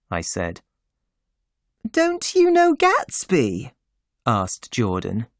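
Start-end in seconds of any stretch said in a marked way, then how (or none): 1.9-3.7 put-on much higher, light young woman voice; a high lively society girl; Jordan's voice. A narrator's reading.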